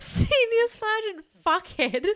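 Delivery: slow, high-pitched, through laughter; laughing echo. Real, not acted. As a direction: draw out the title in a high laughing voice. Laughingly echoes the mock title.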